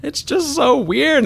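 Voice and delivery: high, slow, teary squeak of disbelief; overwhelmed voice cracking high and slow; tearful disbelief. Retold or imitated. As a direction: recreate that choked-up, squeaky half-sob. Retelling tearful moment with mom.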